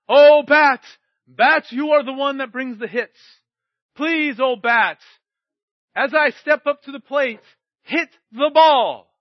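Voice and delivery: loud, pleading prayer to the bat; mock prayer. Preacher mocking worship of a baseball bat, loud pleading. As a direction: beg the bat like an idol, hammed-up.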